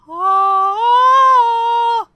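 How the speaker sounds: high, loud sustained heavenly "ahh"; movie revelation choir. Retold or imitated. Host mimicking a movie moment of discovery, revelation choir. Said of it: "that moment you see in movies".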